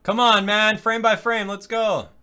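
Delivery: high, loud egging on; impatient urging. Real, not acted. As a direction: urge the stubborn player along loudly. Urging the video player along.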